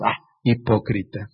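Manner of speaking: low, dismissive scoff; mock-proud low scoff; scornful sneer. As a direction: sneer it down your nose. Mocking proud believers.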